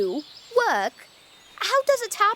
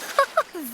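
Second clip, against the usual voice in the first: short scornful laugh at the pebble; mocking laugh; 4 semitones higher than usual. Boy laughs at Hugh's tiny boulder, mocking. Boys mock Hugh's small rock.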